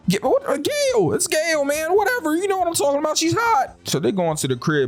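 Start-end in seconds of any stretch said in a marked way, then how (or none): 0.1-3.8 high, laughing excited-dude voice, much higher; excited Stu. Retold or imitated. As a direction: gush like a starstruck teen boy. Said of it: "And Stu's like".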